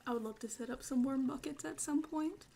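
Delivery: normal talking voice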